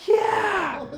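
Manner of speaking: very high, comic squeak; high startled yelp of yes; squeaky yeah. Retold or imitated. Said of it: "And I was like".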